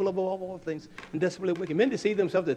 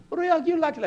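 much higher, louder mock wife; voicing his wife; 7 dB louder, faster than usual. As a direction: ask it pitched up as the wife. Wife answers high.

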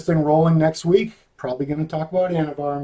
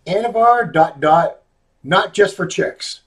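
Anavar dot dot, not just for chicks, read out slowly as a headline; pitching a joke video title to the doctor, slow and deliberate; 3 semitones higher, slower than usual. Shown as a demonstration. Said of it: "Can I give you a title?".

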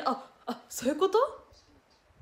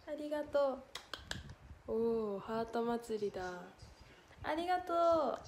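ordinary talk

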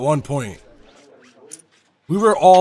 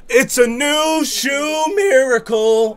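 real ecstatic shouting, much higher and louder; screaming with excitement; 9 semitones higher, 6 dB louder than usual. Real, not acted. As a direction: yell it like the winning hand just landed. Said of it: "I'm losing my voice".

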